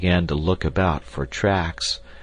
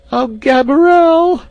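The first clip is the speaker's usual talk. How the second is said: very high, loud scream; 12 dB louder than usual. A narrator's reading. Maid wakes and sees the jungle, terrified scream. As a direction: scream in terror, then faint. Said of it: "she screamed".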